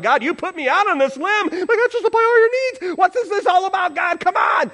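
high, loud, exasperated rant; mad at God. Retold or imitated. Husband out on a limb complaining to God, mad. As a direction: let it burst out, protesting at the top of the voice. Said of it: "starting to get mad at God".

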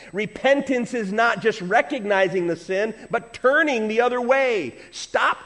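fiery revival preaching; full-force preaching. Acted. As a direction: drive it home from the pulpit, slowing hard on stop doing it. Preaching climax.

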